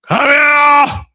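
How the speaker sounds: high, hollered mock-dad summons; comic parental holler; angry dad. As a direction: imitate a father calling his son in for punishment. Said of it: "my dad would have been like".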